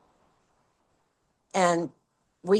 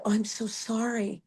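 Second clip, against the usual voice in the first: whispered, much softer apology; meek whisper; 4 semitones higher, 8 dB quieter than usual. Retold or imitated. Lenora whispering sorry to the furious director.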